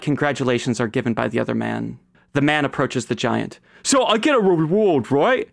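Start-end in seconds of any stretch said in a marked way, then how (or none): 3.8-5.5 high, loud, excited goon voice; eager henchman. Acted. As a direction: push it high, angling for a reward. Eager henchman voiced by game master.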